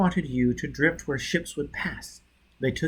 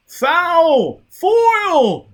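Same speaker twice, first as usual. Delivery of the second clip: much higher, loud howl; 10 dB louder than usual. A narrator's reading. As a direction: howl the name in panic. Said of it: "Bunny howled".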